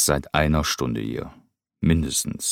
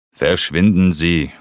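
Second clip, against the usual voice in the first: loud, slow, raised-pitch dismissal; called out; 5 semitones higher, 9 dB louder, slower than usual. A narrator's reading. Rupert sending the cook away, loud call. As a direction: shout it to drive someone off. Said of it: "rief Rupert".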